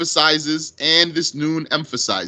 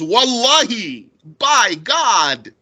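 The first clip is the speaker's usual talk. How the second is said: normal talking voice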